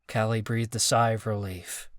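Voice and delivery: ordinary delivery, nothing marked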